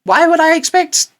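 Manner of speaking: high, startled inner voice; squeaky disbelief at the sudden voice; shocked thought. Retold or imitated. Telling friends how the voice shocked him, high and startled.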